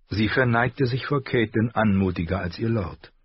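normal talking voice

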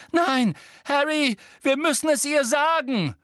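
sehr hoch und laut flehend; schreiend mit gebrochener Stimme. A narrator's reading. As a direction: schrei es verzweifelt heraus. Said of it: "schrie Hermine mit gebrochener Stimme". (verzweifeltes, hohes Schreien)

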